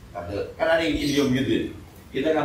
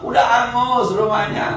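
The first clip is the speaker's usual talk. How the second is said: higher, louder, slower exasperated scold; 9 semitones higher, 6 dB louder than usual. Retold or imitated. Preacher mimicking a nagging neighbour, loud exasperated scold. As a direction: rub it in that the house is already gone. Scolding after house burned.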